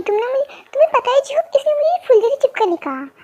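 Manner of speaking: ordinary delivery, nothing marked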